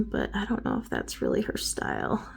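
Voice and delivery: whispering. Real, not acted. Aside about daughter's taste.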